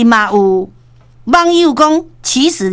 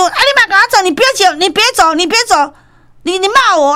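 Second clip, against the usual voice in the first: chasing shout; 9 semitones higher than usual. Retold or imitated. Manager chasing the couple, yelling after them. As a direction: call after him, chasing. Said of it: "一路追就".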